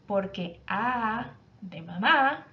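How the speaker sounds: put-on slowly, like a little boy explaining; slow child's answer. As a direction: stretch each sound out. (niño que responde muy despacio)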